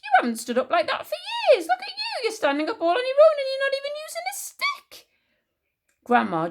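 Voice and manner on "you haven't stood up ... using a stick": high, loud amazed gushing; excited crying out. A narrator's reading. George marvelling at Grandma standing, high and excited. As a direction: gush in amazement, pitched high. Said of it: "George cried".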